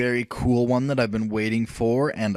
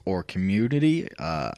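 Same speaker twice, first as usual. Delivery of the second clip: normal talking voice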